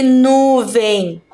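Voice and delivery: "nuvem" drawn out, leaning hard on "nu"; stress on NU. Shown as a demonstration. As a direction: stretch and push the "nu" of "nuvem". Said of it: "a sílaba nu". ("nuvem" com "nu" forte e alongado)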